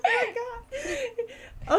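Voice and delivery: laughing hard, high, words squeezed out; helpless giggling, "Oh my God" slowly stretched; real laughter. Real, not acted. Real laughing fit at the scene.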